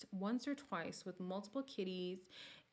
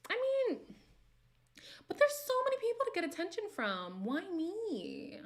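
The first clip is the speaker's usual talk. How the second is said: high-pitched fed-up whine; high exasperated; 11 semitones higher, 5 dB louder than usual. Real, not acted. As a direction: throw it up high, annoyed.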